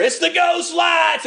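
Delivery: high, drawn-out cartoon holler; Tow Mater. Preacher quoting the Cars movie to congregation, mock spooked holler. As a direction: holler it in hammed-up alarm. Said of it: "uh, Tow Mater".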